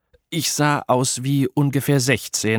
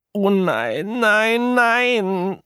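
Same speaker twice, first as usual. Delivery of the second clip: high, rising cries of dismay; horrified wail; 12 semitones higher, much slower than usual. A narrator's reading. Apollo staring at his belly, horrified. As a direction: wail as the horror sinks in.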